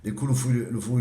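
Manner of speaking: ordinary delivery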